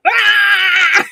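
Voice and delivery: loud, high yell of frustration; hammed-up frustrated shout; mock frustrated cry. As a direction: let out a strangled cry. Imitating Andre's tie meltdown.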